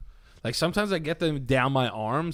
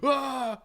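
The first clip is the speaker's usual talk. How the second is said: much higher mock yell of pain; imitating a scream; 11 semitones higher than usual. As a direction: brother waking with stuck arms, retold scream. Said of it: "scream in the morning".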